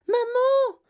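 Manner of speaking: very high, loud shriek; Veruca Salt's sudden cry. A narrator's reading. Spoilt girl calling her mother, sudden shriek. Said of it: "cria soudain Veruca Salt".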